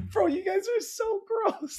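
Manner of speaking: very high, drawn-out mock disgust; mock grossed-out squeal. Onlooker reacting to bandmates passing a spittoon, high grossed-out squeal. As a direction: squeal it slowly, playing up the disgust.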